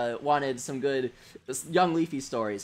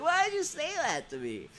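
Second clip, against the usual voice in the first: much higher, softer, wounded whine; whiny hurt quote; 11 semitones higher, 5 dB quieter than usual. Retold or imitated. Punched kid whining at the boy who insulted him, high and hurt. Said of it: "If you were just like".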